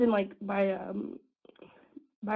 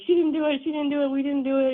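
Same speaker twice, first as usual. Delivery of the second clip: louder, higher imitated protest; frantic pleading; 6 semitones higher, 6 dB louder than usual. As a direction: act out her rapid-fire denial. Retells girlfriend pleading their innocence.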